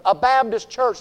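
normal talking voice

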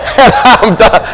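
laugh, then talk through it. Real, not acted. Preacher amused at his talking-dog scenario, laughing.

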